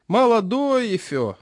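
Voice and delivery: put-on high, slow lisping old man; toothless old man. A narrator's reading. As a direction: mumble slowly through gums. Chairman voiced.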